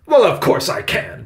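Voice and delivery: loud, high, insistent retort; persisting loudly. A narrator's reading. Victoria insists she can blame Benny, loud retort. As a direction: insist loudly, brooking no argument. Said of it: "Victoria persisted loudly".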